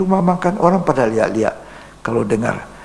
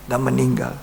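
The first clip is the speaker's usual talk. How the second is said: usual speaking voice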